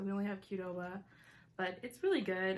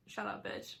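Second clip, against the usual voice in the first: whispered aside; 6 dB quieter than usual. Real, not acted. Whispered self-insult aside.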